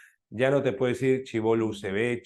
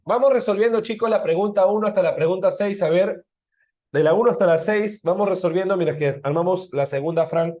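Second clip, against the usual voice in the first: calling out; 7 semitones higher, 6 dB louder than usual. Real, not acted. Teacher announcing task to class.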